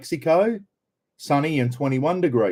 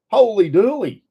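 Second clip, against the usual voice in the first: surprised exclamation; 10 semitones higher, 5 dB louder than usual. Real, not acted. Reacting to Denver's heat.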